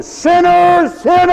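high, loud accusing shout; conscience shouting. Retold or imitated. Preacher voices conscience shouting sinner, high and loud. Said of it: "It won't shout at you".

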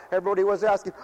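usual speaking voice

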